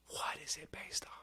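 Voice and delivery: hushed, breathy whisper; whispered question. Real, not acted. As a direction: whisper it like a mystery.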